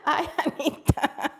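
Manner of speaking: real giggling through the words; laughing. Real, not acted. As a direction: greet her while cracking up. Cracking up over the name mix-up.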